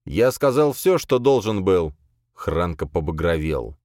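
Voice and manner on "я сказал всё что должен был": usual speaking voice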